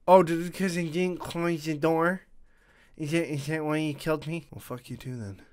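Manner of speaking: normal talking voice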